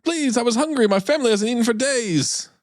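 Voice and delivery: high-pitched mock begging; pleading prisoner. Prisoner begging Cardinal Curry for mercy, mock pleading. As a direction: imitate a desperate prisoner's plea, high.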